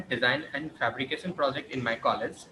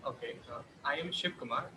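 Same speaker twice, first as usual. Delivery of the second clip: very faint, far from the mic; speaking too softly to hear; barely audible; 11 dB quieter than usual. Real, not acted. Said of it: "Your voice is very low".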